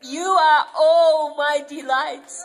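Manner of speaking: put-on Father fully accepting his children; father's delight. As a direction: proclaim his joy over them. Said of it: "them and says".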